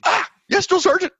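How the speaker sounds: high eager recruit voice. Retold or imitated. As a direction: chirp it like a keen private. Eager recruit reply retold.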